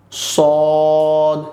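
সদ held long with rounded lips. Shown as a demonstration. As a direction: round the mouth and stretch সদ. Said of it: "ঠোঁটকে একটু গোল করে আমরা বলবো". (ঠোঁট গোল করে সদ)

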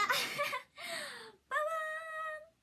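high, slow drawn-out reveal sound; ta-da fanfare. Real, not acted. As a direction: proudly unveil the cake. 商品を見せる効果音. (高く伸ばすジャジャーン)

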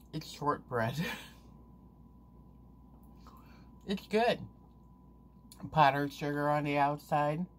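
talking while chewing food; words muffled by pastry; mouth full, chewing. Real, not acted. Seen 0: Lips pressed and working over food while she holds a bitten piece.

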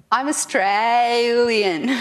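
slow, loud, drawn-out Australian vowels; broad Australian accent. Shown as a demonstration. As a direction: lay the Australian accent on thick and stretch it out. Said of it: "so I speak Australian".

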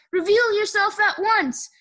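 high, loud commanding call; shouted. A narrator's reading. Eugenia searches for Baby, shouted. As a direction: order the hider out. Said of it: "shouted Eugenia".

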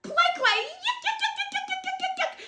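high squeaky yuck chant; disgusted yuck. A narrator's reading. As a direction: spit out the taste in high-pitched disgust. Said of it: "He says".

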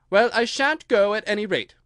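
high, loud refusal; defiant. Acted. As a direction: stand your ground against the king. Alice defies the king.

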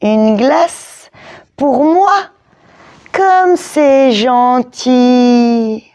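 sneering fake gratitude, drawn out; sarcastic bully voice; mock sweet. A narrator's reading. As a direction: purr it with a nasty grin. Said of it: "il eut un méchant sourire".

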